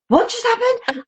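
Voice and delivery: high, bewildered what-just-happened; stunned disbelief. Retold or imitated. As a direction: ask it high, totally thrown. Stunned at the book's ending.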